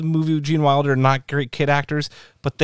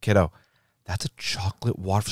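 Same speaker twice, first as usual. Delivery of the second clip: softer, lower parental aside; explaining parent; 4 semitones lower, 6 dB quieter than usual. Retold or imitated. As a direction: break it gently to a kid. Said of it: "And I was like".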